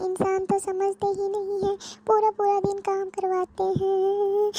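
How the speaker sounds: high, whining plea to go home to mummy; tearful pleading. Acted. Daughter pleads tearfully with mother. (माँ से रोते हुए गिड़गिड़ाना)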